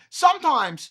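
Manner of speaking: normal talking voice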